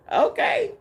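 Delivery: high squeal of glad surprise; squealed okay. Retold or imitated. Thrilled at the spirit's call, squealing.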